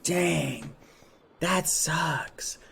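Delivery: hushed, near-whispered disappointment; dejected. Retold or imitated. Streamer hears friend isn't coming, acted quiet dejection. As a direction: let it sink into a soft, defeated murmur. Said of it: "I was so sad".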